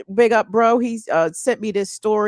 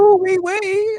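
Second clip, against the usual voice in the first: much higher, slow, drawn-out "Ooh, wee, wee"; silly squeal; 9 semitones higher, 4 dB louder, much slower than usual. Retold or imitated. Cohost echoing the inside-joke greeting in a high squeal. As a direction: stretch it out in a goofy high voice. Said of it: "I said".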